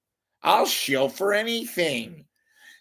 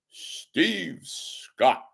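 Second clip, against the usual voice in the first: a hint of a stuffy radio announcer; puffed-up newsman tone, lightly done; stiff broadcaster voice. Radio host mocking a stuffy newsman, lightly voiced. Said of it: "I'm gonna talk like this".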